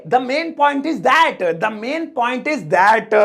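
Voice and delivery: slow, drawn-out words for learners; slow teaching model. Shown as a demonstration. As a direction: model the English phrase slowly. Teacher models the new phrase.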